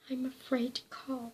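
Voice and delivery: breathless, whispered plea; frightened gasp. A narrator's reading. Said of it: "she gasped".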